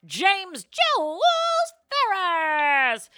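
high, drawn-out ring-announcer call; grand name announcement. Pretend.

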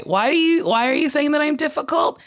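ordinary delivery

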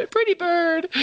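high parrot squawk with a laugh. Retold or imitated. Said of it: "It's like Petey from".

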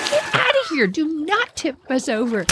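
high, forceful mock warning; a retold tough-girl threat; acting tough. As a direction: scare the boys off, playing tough. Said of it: "I could act pretty tough".

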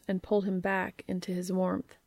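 usual speaking voice

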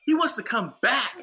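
high, loud incredulous announcement; mocking disbelief. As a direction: imitate someone appalled and telling his buddy. Retold guy mocks his request.

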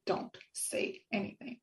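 hushed, almost breathless warning; a near-whispered inner warning not to speak; mind's voice. Retold or imitated. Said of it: "in my head, it was like".

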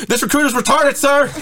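high-pitched screamed recruit response; mock recruit scream. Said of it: "And then I screamed".

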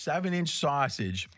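ordinary delivery

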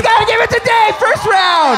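high, excited victory call; triumphant shout. Real, not acted. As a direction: crow the round win to the room. Celebrating round win.

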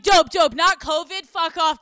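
loud, brisk, dismissive relief; excited sister. Retold or imitated. Sister relieved it's not covid, loud brisk excitement. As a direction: blurt it out like it's great news. Sister voiced cheering the diagnosis.

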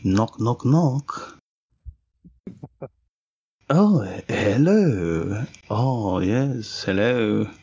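lightly put-on French accent, unhurried. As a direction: play a French villager knocking next door, hammy French accent. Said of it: "do it again with a French accent".

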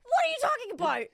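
acted disbelief. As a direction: squeal it in exaggerated disbelief. Retold baffled reply to TikTok man.

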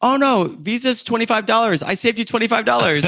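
very high, beaming sales pitch; proud driver. Retold or imitated. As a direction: make it sound like he did you a favor. Driver proudly claims he saved money.

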